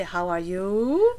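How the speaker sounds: "you" stretched out and climbing; slow upward slide on "you"; English question rise. Shown as a demonstration. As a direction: draw out "you" with a rising glide. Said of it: "In English, however, you say".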